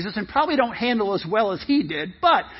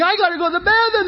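high, fast, hurried plea; desperate kid; 8 semitones higher, much faster than usual. Acted. Kid at the gas stop needing bathroom, high and rushed. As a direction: blurt it out while dashing.

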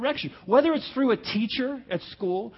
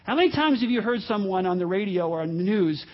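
normal talking voice

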